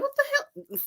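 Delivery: high, stunned mock disbelief; reading the comments, stunned; shocked. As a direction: gasp it out in disbelief. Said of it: "I'm underneath there like".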